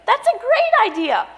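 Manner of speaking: high, bright burst of enthusiasm; delighted realization. Acted. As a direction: seize on the idea with delight. Delighted by mother's suggestion.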